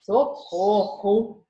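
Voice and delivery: very slow, mocking drawn-out "Socorro!" with no real alarm; ironic cry for help. Shown as a demonstration. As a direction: say "Socorro!" sarcastically, not meaning it. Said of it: "a segunda intenção do socorro era mais, é, irônica".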